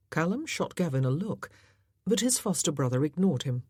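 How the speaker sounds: ordinary delivery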